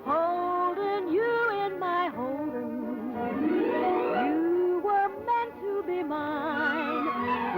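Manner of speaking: ordinary talk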